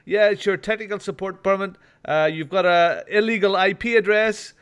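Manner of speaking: mimicking a scam caller with an Indian accent. Tells co-hosts about a scam call, mock Indian accent. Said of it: "very clearly a Pakistani Indian call center".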